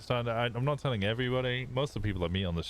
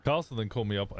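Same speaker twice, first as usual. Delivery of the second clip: ordinary delivery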